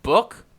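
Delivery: "book" with the short vowel of look; standard "book". Shown as a demonstration. Listing three ways to say it, short-vowel "book". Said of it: "There are three ways to say it".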